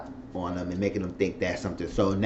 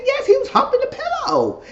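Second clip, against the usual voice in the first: very high, squealed retort; exclaimed in a high put-on voice; high-pitched exclamation; 4 dB louder than usual. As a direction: squeal it out while retelling. Exclaims own reply in retelling.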